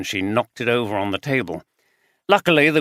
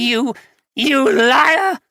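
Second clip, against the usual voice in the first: slow, much higher, louder accusing shout; 14 semitones higher, 6 dB louder, slower than usual. Acted. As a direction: hurl the word liar at him. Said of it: "That last phrase was shouted".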